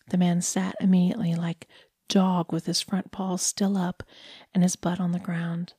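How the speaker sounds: ordinary delivery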